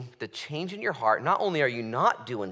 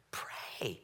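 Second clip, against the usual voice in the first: soft unvoiced whisper of Pray; whispered plea; 5 semitones lower, 12 dB quieter than usual. Shown as a demonstration. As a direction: breathe the word Pray. with no voice.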